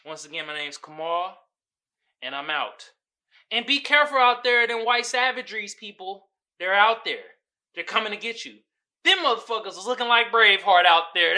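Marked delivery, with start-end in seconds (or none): none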